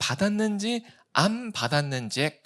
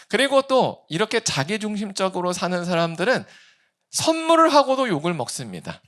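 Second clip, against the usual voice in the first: normal talking voice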